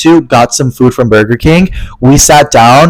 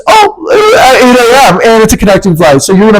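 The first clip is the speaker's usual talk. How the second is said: exasperated cry; 8 semitones higher than usual. Retold or imitated. Retelling the gate agent's news with a high exasperated cry. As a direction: cry out at the awful flight time. Hearing about the early connecting flight.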